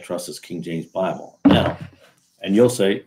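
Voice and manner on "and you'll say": normal talking voice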